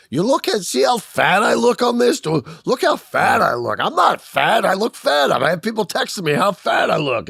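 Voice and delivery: high, loud whiny complaint; mock self-conscious host. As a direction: squeal it like a vain host. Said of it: "They're like".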